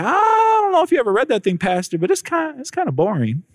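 new believer telling the pastor the Bible is boring, cheeky then sheepish; cheeky complaint. Retold or imitated. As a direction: blurt it out high, then sheepishly admit it.

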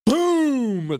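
loud, high falling boom; imitated impact boom. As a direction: drop a sudden loud boom. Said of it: "That's what it sounded like".

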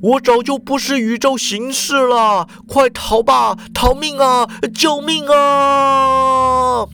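stretched, whimpering cry for help; wailing. A narrator's reading. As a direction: bawl and flee. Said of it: "池田抱头痛哭啊，大叫".

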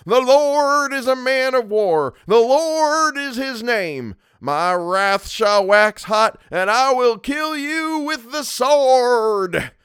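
high, booming, drawn-out bellow; bellowed scripture. A narrator's reading. Minister to his congregation, bellowing scripture at full force. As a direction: thunder it slowly, stretching every word. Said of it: "when he bellowed".

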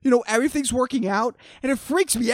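normal talking voice

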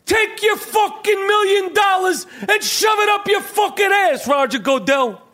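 high-pitched furious tirade; hammed-up enraged coach shouting; Danny Aiello. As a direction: hurl a defiant insult in a sustained yell. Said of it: "That's Danny Aiello as the head coach of the New England Patriots".

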